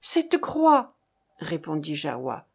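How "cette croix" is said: higher-pitched, stirred reply; agitated exclamation. A narrator's reading. Farmer shows Marcof the cross he found, agitated.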